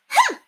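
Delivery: sharp, high, loud huff of fury; furious humph. Acted. Lady huffs in speechless fury at the governess. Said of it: "speechless with fury".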